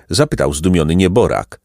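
ordinary delivery, nothing marked

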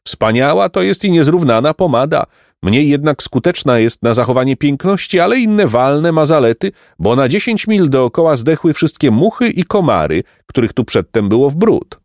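high, proud bragging; boastful count. Acted. The count praising his pomade to the boys, boastful. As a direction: show off the pomade.

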